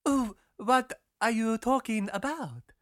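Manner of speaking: high, slow, bewildered question; puzzled girl. A narrator's reading. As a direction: draw it out high, utterly confused. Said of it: "very puzzled".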